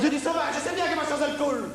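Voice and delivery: high whiny reply; Gilbert's whine. Acted. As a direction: whine it out, trailing off. Gilbert answers, squeaky.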